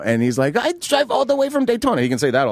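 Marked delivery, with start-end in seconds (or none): 0.6-2.0 high-pitched mock foreign buyer; protesting buyer. Voicing the protesting van buyer.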